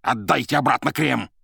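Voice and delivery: громко, повышенным тоном требовать назад; злой громкий приказ; сердитое требование. Acted. Азазелло требует крем назад. (сердито, требовательно)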